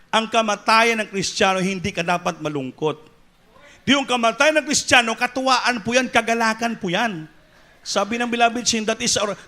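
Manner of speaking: loud, high preacher's cadence; fervent pulpit delivery; full-force preaching. Real, not acted. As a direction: preach it out to the church.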